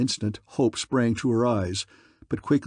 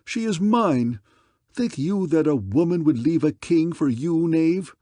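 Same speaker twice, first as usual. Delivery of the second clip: jealous scorn; 5 semitones higher, 3 dB louder than usual. Acted. King jealously defying rival.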